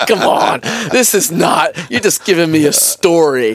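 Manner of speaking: loud, high-pitched incredulous protest; mock disbelief. Man recalling doubting his seatmate's story, in loud mock disbelief.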